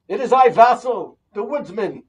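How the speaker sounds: booming declaration at the door; loud announcement. Acted. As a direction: proclaim who you are, loudly. Rabbi announces himself at door.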